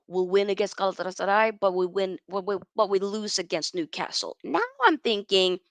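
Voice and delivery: ordinary delivery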